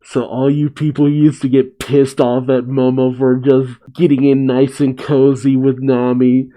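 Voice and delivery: ordinary delivery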